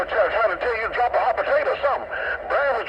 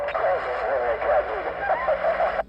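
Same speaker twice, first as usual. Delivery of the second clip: low chuckle off the mic; laughing low at the radio banter; deep laugh; 10 semitones lower, 3 dB quieter than usual. Real, not acted. Signing off on the CB radio, then a deep chuckle.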